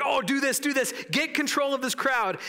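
high, urgent mock crowd orders; shouted instructions. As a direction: hurl hurried advice from the crowd. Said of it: "they shouted instructions to him".

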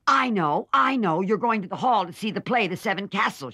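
gleeful gotcha, I figured it out; cried out. A narrator's reading. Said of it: "she cried".

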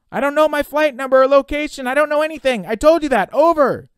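loud, very high, exasperated panic; panicked high-pitched shouting; frantic outburst. Acted. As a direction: yell it desperately into the mic. Desperate outburst into the radio.

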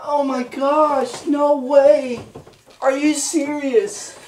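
slow, high, loud disbelief; drawn-out shocked gasp of joy; stunned surprise. Real, not acted. Husband hearing wife is pregnant, loud amazed.

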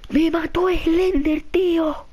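high, slow wail of defeat; distraught wail. Real, not acted. Player telling Alba Slender killed him, distraught wail. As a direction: draw it out high, utterly gutted. Wailing after game death.

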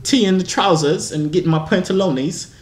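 improvised British impression, pitched high; mock British accent. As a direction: ham up a playful British accent. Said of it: "you like the British".

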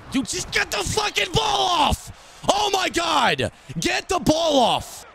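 high, slow, shouted fury; furious shout. Real, not acted. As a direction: scream at the screen for the throw. Screaming at his quarterback to throw.

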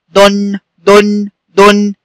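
short u in دن, then hum the n; damma tanween. Shown as a demonstration. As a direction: say دن as "dun" with a held n. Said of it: "تنوين بالضم". (نطق دن "دُنْ" بنون ساكنة)

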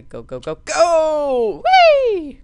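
rising urgent yell into a squeal; loud, high burst of glee; excited cheering. Real, not acted. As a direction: urge it on, climbing to a high whee. Urging character on, then flying.

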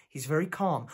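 soft, measured doctor's reassurance; calm reassurance. Acted. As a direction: soothe a worried relative. Doctor reassures worried fiancée.

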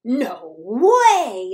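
loud, astonished "no way"; exclaiming. Acted. The animals refusing to believe the mouse, loud exclaim. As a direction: blurt it out in shock. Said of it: "exclaimed all the animals".

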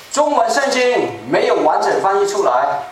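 ordinary delivery

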